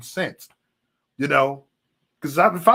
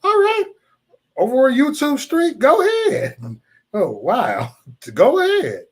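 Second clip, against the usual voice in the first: higher taunting dare; 10 semitones higher, 4 dB louder than usual. Real, not acted. Daring a troll in chat, much higher and louder.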